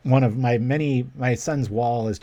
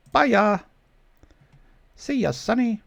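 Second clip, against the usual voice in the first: high, loud mock farewell; gleeful taunt; 12 semitones higher, 3 dB louder, slower than usual. Real, not acted. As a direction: wave the card off with glee. Gleefully bouncing opponent's creature.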